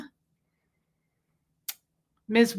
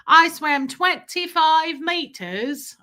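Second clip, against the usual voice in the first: put-on high, slow British little-boy voice; stretched-out, singsong British kid accent; 5 semitones higher, 3 dB louder, slower than usual. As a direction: imitate a proud British child, drawn out. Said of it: "I remember him going".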